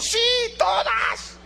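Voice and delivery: grito agudo de susto; asustado. Retold or imitated. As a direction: soltar el sí todas con un grito asustado. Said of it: "él se asustó y dijo". (joven asustado grita ante todos)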